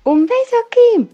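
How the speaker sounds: squeaky high helium voice; high squeaky sign-off. Pretend. Caller signs off in helium voice.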